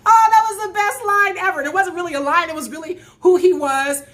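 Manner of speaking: high, loud delight straight after laughing; loud, gleeful outburst. Real, not acted. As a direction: exclaim it, thoroughly tickled. Delighted after laughing at his line.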